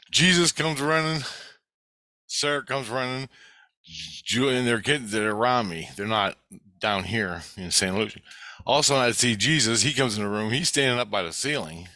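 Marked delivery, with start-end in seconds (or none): none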